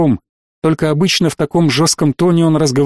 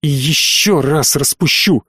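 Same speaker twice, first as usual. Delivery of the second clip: plain reading voice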